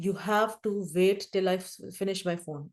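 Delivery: slow firm instruction. Retold or imitated. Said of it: "میں نے جیسے اپنے بچوں کو یہ کہا".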